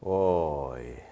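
low, soft guttural moan; lamenting oy. Acted. Preacher closing the passage, soft low lament. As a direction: let it fall away in grief. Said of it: "woe spoken as lament".

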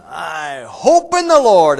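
loud, slow, mock-pious declaration; mock pious drone. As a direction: drag it out like a self-satisfied believer. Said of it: "So I'm just sitting there going".

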